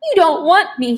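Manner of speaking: high, blubbering begging; sobbed plea. A narrator's reading. Crying boy pleading, voice up. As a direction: Wendell sobs at the vampire, high and tearful. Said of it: "he sobbed".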